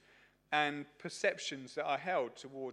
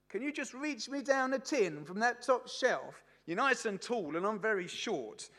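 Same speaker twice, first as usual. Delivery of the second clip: high, louder imitation of a shopper asking a favour; voiced polite shopper; 8 semitones higher, 6 dB louder than usual. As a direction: put on a high-pitched voice for a sweet request. Acted shopper asking for help.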